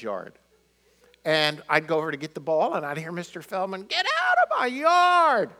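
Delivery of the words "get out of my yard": put-on high, loud shout of a cranky old man; angry neighbor yelling. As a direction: bark it like a furious neighbor. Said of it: "He'd be yelling at me".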